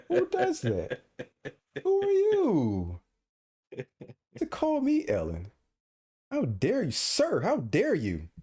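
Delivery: hammed-up high-pitched outrage; mock outrage. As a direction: shriek in pretend offense.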